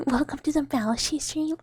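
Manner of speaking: ordinary talk, nothing marked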